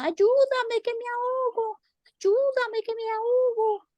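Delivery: very high squeaky plea; drowning cricket pleading. Acted. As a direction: cry for help in the cricket voice. Cricket drowning, begging mouse.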